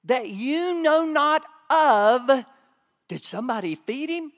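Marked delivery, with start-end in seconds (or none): 0.0-2.4 slow scripture quote. Acted. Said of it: "Jesus said".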